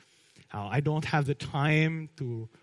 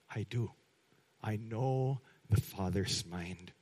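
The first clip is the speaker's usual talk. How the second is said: quiet voice of Jesus; 5 semitones lower, 7 dB quieter than usual. Retold or imitated. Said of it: "who says".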